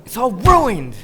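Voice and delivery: high, loud cry of despair; shouting in dismay. Real, not acted. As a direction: wail that everything is lost. Discovers the audio is lost.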